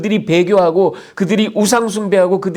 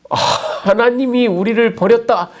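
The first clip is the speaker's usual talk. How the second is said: reproachful complaint; 7 semitones higher than usual. Acted. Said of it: "하나님을 비난하고 있습니다".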